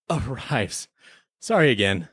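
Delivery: ordinary delivery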